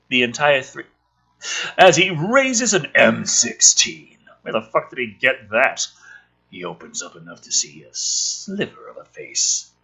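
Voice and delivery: usual speaking voice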